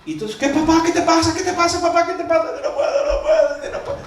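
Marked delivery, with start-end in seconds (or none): 0.4-2.4 high, anxious pleading questions; a son fearing his father collapsed; worried son. Retold or imitated. Son rushing in to his screaming father, high and alarmed. 2.4-4.0 squeaky laughing "no puedo"; breaking up with laughter, overwhelmed. Retold or imitated. Father overwhelmed by the multiplying money, laughing high.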